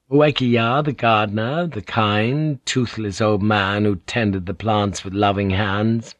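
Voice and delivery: ordinary delivery, nothing marked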